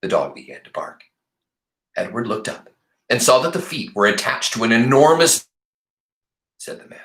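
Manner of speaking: ordinary delivery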